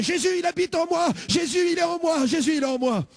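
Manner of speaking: high, jubilant shouts; joyful shouting. Retold or imitated. Young convert leaving church shouting his joy, jubilant. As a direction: cry it out to the street in joy. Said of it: "en poussant des cris".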